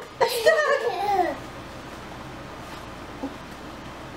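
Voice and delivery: soft unvoiced giggling; breathy giggle. Real, not acted. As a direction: giggle quietly. Laughing after toe.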